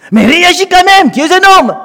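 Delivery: put-on a young son's loud, high-pitched protest; indignant son. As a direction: push him to fight back, voice up high. Son urging his insulted father.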